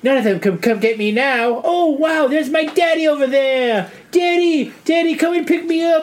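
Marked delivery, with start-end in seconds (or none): 1.6-6.0 put-on little boy calling daddy. Boy in story calls to dad.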